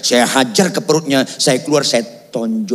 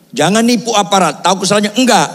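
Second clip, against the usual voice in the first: raised-pitch mock police challenge; a testy roadside exchange, pitched up; confrontational; 8 semitones higher, faster than usual. Policeman questioning the driver at his window, mock confrontational. Acted quarrel with traffic officer.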